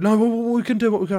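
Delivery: high, loud flustered stammer; imitated panic. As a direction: gabble it like a panicking mum. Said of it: "goes into a bit of a panic mode".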